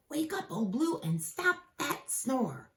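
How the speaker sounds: lightly voiced Jack calling; a hint of a kid calling out; child calling out. Jack wakes the dog.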